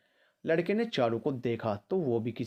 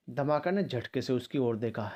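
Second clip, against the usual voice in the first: plain reading voice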